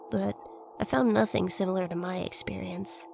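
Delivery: plain reading voice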